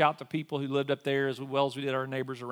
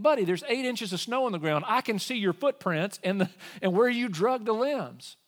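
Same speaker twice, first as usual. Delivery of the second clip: exasperated; 8 semitones higher, 4 dB louder than usual. Retold or imitated.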